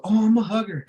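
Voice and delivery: high, lightly sleazy excuse; a hint of the creep excusing himself; creepy hugger. Man explaining why he hugs, light high sleazy voice. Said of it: "then he's like".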